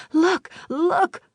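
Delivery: put-on high, eager child voice; excited child. A narrator's reading. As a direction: call out like a thrilled kid.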